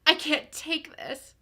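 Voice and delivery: usual speaking voice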